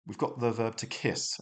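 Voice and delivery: ordinary talk, nothing marked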